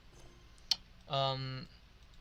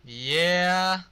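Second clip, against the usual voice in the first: loud, higher whoop of joy; excited cheer; 5 semitones higher, 8 dB louder than usual. Real, not acted. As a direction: cheer it like the show just started. Cheering as the cutscene starts.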